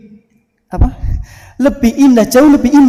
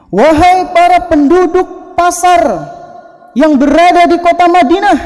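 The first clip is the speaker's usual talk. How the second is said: shouting; 10 semitones higher, 4 dB louder than usual. Acted. Abu Hurairah calls to the market crowd, shouting. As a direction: call out at full voice to a crowd. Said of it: "beliau teriak".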